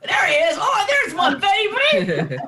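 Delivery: high, loud shout of recognition; excited shout. Real, not acted. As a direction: call out with delight on spotting him. Spotting him in a photo.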